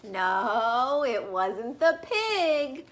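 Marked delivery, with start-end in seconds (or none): none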